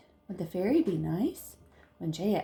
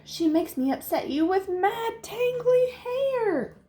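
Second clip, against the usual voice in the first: much higher, building line; high dramatic; 12 semitones higher, 4 dB louder than usual. Acted. Fairy complains to Jaya in a high dramatic voice. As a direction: sing it up high.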